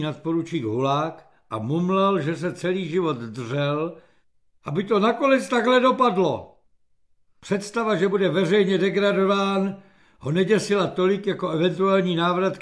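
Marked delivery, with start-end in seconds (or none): none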